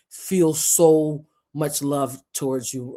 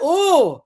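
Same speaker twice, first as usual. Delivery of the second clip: angry Aries ooh; 14 semitones higher, 7 dB louder than usual. Retold or imitated. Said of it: "I'm just like".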